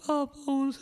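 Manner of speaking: very high mock-sobbing wail; exaggerated sad, high-pitched whine; really sad. Shown as a demonstration. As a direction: wail it like a hammed-up crybaby. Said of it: "say it really sad".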